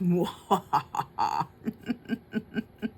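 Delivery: cackling Halloween witch laugh; a long wicked cackle. Acted. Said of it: "I do my witch cackle".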